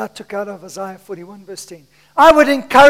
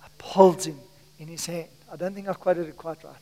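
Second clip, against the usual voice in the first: whisper; 5 semitones lower, 10 dB quieter, faster than usual. Acted. Preacher drops to whisper.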